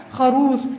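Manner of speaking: خروث ending on th instead of f; lisped f. Shown as a demonstration. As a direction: say خروث, tongue-tip th for the final f. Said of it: "لدغة حرف الفاء بتتنطق ثاء".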